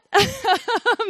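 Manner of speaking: a loud, high nervous laugh; high embarrassed laugh. Real, not acted. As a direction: laugh it off, a bit sheepish. Laughs at admitting she relates.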